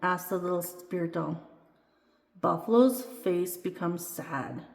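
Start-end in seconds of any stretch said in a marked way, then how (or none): none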